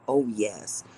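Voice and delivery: ordinary talk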